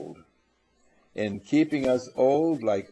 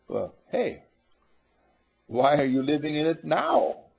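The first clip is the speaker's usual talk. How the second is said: normal talking voice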